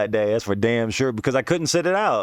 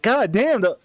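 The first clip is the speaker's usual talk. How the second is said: rising to the crowd's backing; riled up; 9 semitones higher than usual. Retold or imitated. Retelling being hyped as the inmates join in, excited and high. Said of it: "I'm getting all riled up".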